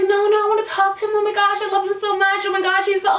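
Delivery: put-on high, fast, gushing tipsy girl; drunk gushing friend. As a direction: gush over him like a lovestruck drunk friend. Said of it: "And she's like".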